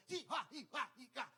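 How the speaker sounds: high, quick karate-style yelps; imitated spider noises. Comic mimicking a darting spider, mock fighting noises.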